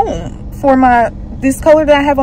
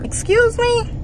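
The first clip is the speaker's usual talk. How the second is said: high, incredulous excuse me; indignant excuse me; 12 semitones higher, 4 dB quieter, slower than usual. Retold or imitated. Retelling the salon owner's refusal, high outraged disbelief. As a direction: pitch it up in disbelief.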